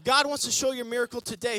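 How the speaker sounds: loud, rising preacher's build; preaching. Acted. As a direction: preach it out to the church. Preaching climax.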